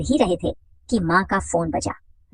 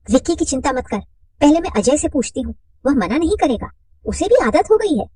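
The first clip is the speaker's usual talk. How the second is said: higher, confident friend; reassuring woman's voice; 7 semitones higher, 4 dB louder than usual. Acted. Mother reassuring Radha, higher. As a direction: voice her up high, sure of herself.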